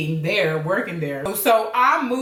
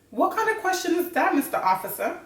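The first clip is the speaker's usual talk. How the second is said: mock-rude, pitched up at first; 10 semitones higher than usual. Practicing backtalk to a police officer, mock rude. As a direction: play at sassing the officer. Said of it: "like, rude with it".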